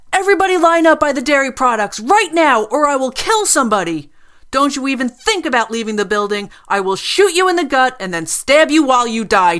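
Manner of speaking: loud, high, unhinged yelling; furious threats. A narrator's reading. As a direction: bark orders like a manic gunwoman. Armed woman threatens customers.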